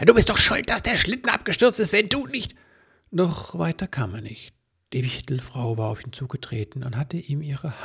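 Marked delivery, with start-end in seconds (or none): 0.1-2.6 much higher and louder, fast angry tirade; furious shout. A narrator's reading. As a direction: pile the blame on her, rushing. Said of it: "schrie er".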